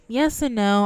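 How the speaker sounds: ordinary talk, nothing marked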